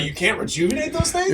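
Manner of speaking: high mock outraged question; mock indignant question. Friend suggesting a comeback, high mock outrage. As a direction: squeal it in fake shock. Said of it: "You should have been like".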